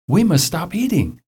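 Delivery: high-pitched mild outcry; mock-alarmed storybook exclamation; crying out. A narrator's reading. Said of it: "cried Toad".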